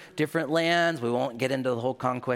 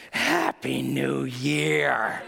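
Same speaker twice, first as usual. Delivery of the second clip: drawn-out gleeful happy new year; much slower than usual. Retold or imitated. Kid lighting his first candle, slow delighted glee. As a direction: stretch it slow, delighted with himself.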